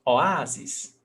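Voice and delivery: ordinary talk, nothing marked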